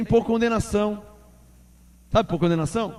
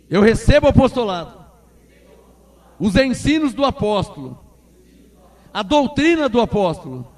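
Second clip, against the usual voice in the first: loud, fervent prayer for the church to repeat; louder congregational prayer, phrase by phrase; led prayer; 7 dB louder than usual. Acted. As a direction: call out each phrase of the prayer with conviction.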